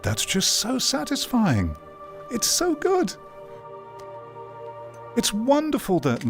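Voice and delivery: very high-pitched, gleeful marvelling; real delight. Real, not acted. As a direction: marvel at it, voice leaping right up. Delighted by satisfying physics in VR.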